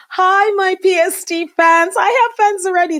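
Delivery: bright, delighted hello, pitch up high; excited greeting. Real, not acted. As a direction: greet the fans with glee, voice lifting. Greets live fans excitedly.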